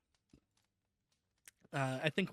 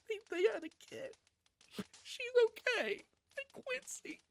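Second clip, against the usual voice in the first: high, tear-choked squeak breaking off; real sobbing through the words; 4 dB quieter than usual. Real, not acted. Streamer crying to chat over the ending, voice breaking.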